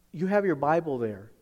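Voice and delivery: ordinary delivery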